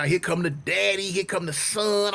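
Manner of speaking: usual speaking voice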